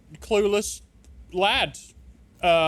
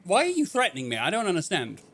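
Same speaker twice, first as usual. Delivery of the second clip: usual speaking voice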